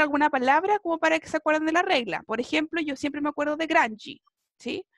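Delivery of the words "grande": "Granchi" said GRAN-dji, for grande; final DE as "dji". Shown as a demonstration. Teacher giving her memory word for the rule, "Granchi" ending "dji". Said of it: "pronunciar siempre como G".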